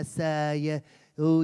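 usual speaking voice

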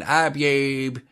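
botched Cockney put-on voice; Cockney chimney sweep. Host mimicking the Australian manufacturer, mock Cockney accent. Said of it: "chimney sweep Cockney British voice".

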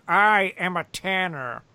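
lightly voiced dubbed villain line; slow, stiff dubbed-film delivery; bad movie dubbing. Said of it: "I'm gonna do the dubbed voice".

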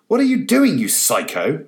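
high, loud outburst; exclaimed. A narrator's reading. Perkins yelling at Francis across the spilled coffee, high and loud. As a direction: blurt it out in shock. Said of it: "exclaimed Perkins".

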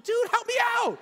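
high, loud panicked yell; desperate shout. Retold or imitated. Asking brother Josh for help, panicked high shout. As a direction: shout to your brother for rescue. Begging brother in retold story.

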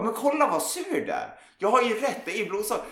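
mock the know-it-all in a squeaky voice; high mocking imitation of a smug friend. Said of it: "Då blir jag irriterad och bara".

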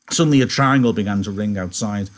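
usual speaking voice

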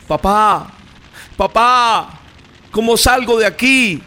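high, loud cry for help; grito de niño. A narrator's reading. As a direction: call out desperately to dad like a trapped child. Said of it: "gritaba ese niño". (grito agudo de niño atrapado)

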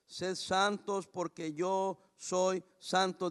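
scripture read slowly, word by word. Shown as a demonstration. Pastor reading the verse slowly to the congregation. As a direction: read it slowly so it won't hurt. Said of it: "Se lo voy a leer despacito".

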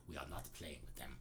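low, quiet mock-serious refusal; imagined stern refusal. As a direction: play the grave band, voice dropped low and soft. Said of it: "they didn't say it like that".

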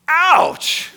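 very high, loud comic cry of hurt; pained yelp. Acted. Reacting to Jeff's blunt criticism, mock pained yelp. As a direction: yelp as if stung.

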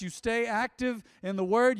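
usual speaking voice

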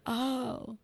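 retold moment of getting it; dawning realization. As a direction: act out the penny dropping. Retelling moment of understanding.

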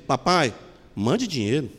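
mother reads it as a gentle plea; tender plea. Retold or imitated. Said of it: "não está escrito assim".

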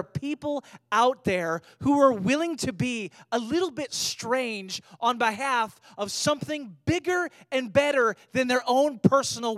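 loud, driving sermon delivery; full-force preaching. Acted. Preacher urges congregation to choose Christ's values, loud impassioned preaching. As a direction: preach it loud, punching key words.

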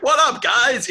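high, loud mock casual greeting; breezy coach greeting. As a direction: toss it off like a coach strolling in. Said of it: "rolled up to the game and was like".

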